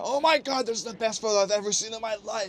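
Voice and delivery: high excited gushing; high imitation of his thrilled praise; mock scream. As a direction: gush like the thrilled photographer. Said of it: "he'll scream about that".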